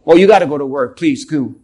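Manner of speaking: hammed-up nagging partner shooing him off; preacher mocking a nagging raven spouse, voice dropped low; mock raven's mate.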